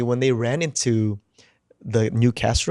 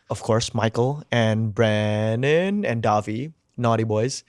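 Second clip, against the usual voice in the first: usual speaking voice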